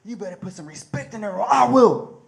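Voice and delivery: loud, menacing warning; threatening. Retold or imitated. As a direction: lean in and threaten him. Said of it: "and he says".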